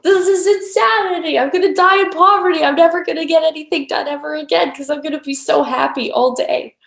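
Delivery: high, loud hammed-up freak-out; mock panic. Acting out her inner doubter, mock panicked. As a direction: wail in exaggerated alarm, rushing in the middle.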